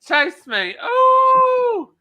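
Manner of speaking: high, loud mock squeal; hammed-up flirty tease. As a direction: playfully beg to be chased, whooping high. Camp pretend flirting, squeal.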